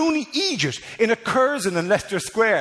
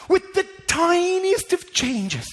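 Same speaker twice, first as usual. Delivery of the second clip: higher, slow, drawn-out emphasis; dramatic build; 10 semitones higher, slower than usual. Shown as a demonstration. Comedian repeating his point, slow and higher. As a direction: stretch it out for effect.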